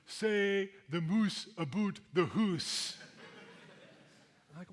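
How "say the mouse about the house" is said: put-on higher, louder, slow teasing Canadian accent; mock Canadian accent. Strangers teasing the Canadian newcomer in a mock Canadian accent. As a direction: Americans hamming up a Canadian accent. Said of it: "I had a distinct accent".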